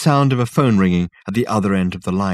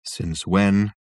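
abject. A narrator's reading. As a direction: repeat it hopelessly. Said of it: "said the ghost abjectly".

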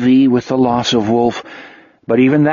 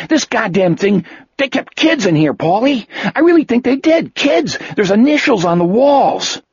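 higher and higher, horrified; horrified cop; 10 semitones higher than usual. A narrator's reading. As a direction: voice climbing in horror.

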